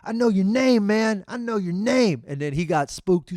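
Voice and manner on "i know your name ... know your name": high, loud threat at the big guy; threatening shout. Retold or imitated. Shouting at the thief who jammed his friend's leg, loud threat. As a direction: call him out, loud and up high. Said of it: "and I was like".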